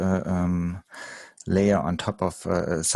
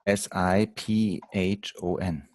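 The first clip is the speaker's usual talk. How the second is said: slowly spelled letter by letter; spelling out; slower than usual. Real, not acted. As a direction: spell each letter out clearly. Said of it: "how do you spell that?".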